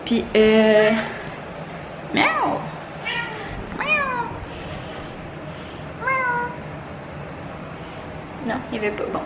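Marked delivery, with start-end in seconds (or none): 2.1-6.5 high meowing; cat meow. Pretend. Calling the cat. (miaulement aigu pour appeler le chat)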